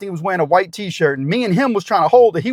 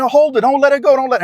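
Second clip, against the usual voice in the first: high, louder pleading call; urgent shout; 7 semitones higher, 4 dB louder than usual. Retold or imitated. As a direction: shout it like bystanders on the bridge. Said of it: "They said".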